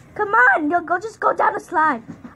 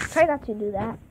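ordinary talk

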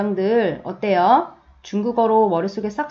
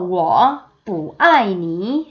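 very slow, syllable by syllable; slow teacher demo; slow model reading; much slower than usual. Shown as a demonstration. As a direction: spell out 你很漂亮 slowly. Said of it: "안이라는 뿌 넣어서".

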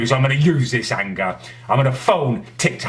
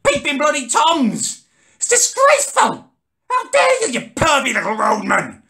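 outraged yell; 14 semitones higher than usual. Acted. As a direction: scream your disgust at the peeping workmen. Yelling at workmen, outraged.

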